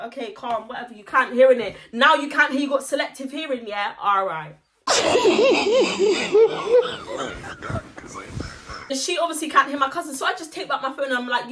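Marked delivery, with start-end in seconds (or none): 4.9-8.9 high, wheezy laughing fit; helpless breathy cackle; long laugh. Real, not acted. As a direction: laugh until it thins to a wheeze. Laughs at her selective hearing.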